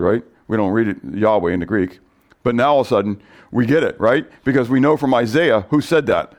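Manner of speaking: ordinary talk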